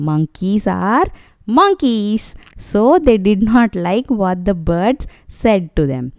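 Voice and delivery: ordinary delivery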